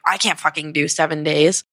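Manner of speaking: ordinary talk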